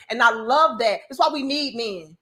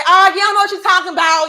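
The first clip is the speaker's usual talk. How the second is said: loud, fast and higher, brushing him off; defiant snap; 6 semitones higher, 9 dB louder, faster than usual. Acted. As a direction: fire it back at him loud and quick. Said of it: "and say".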